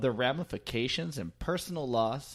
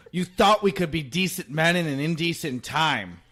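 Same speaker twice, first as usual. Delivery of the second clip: a light snarl, raised and loud; snarling Two-Face; 5 semitones higher, 8 dB louder than usual. Retold or imitated. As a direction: read it as a villain snarling. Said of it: "Harvey Dent, now Two-Face, snarled".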